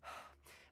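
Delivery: soft, breathy sigh; weary sigh. Acted. Friend's secret has been told, a weary sigh. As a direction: let out a heavy-hearted breath.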